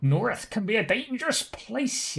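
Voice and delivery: high, slow voice of a game character; put-on character voice. Game character warning of a dangerous place, high put-on voice.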